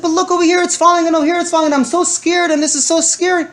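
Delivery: dad fakes terror to his daughter, hammed-up fear. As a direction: pile on mock terror to the child. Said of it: "showed visible expressions of fear".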